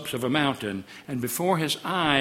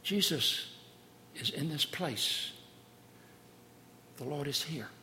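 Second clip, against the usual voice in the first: hushed sermon close; 3 semitones lower, 8 dB quieter than usual. Acted. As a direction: draw the congregation into awe quietly. Hushed closing exhortation.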